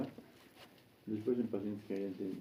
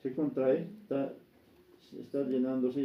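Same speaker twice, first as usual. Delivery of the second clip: louder, higher, in urgent bursts; pressing, raised teaching voice; racing heartbeat demonstration; 4 semitones higher, 5 dB louder than usual. Shown as a demonstration. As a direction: act out a heart with no time to fill. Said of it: "Si hay taquicardia".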